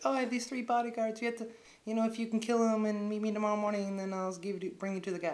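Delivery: high-pitched put-on pleading; mock woman's voice. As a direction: imitate the woman striking a deal. Voicing woman's request.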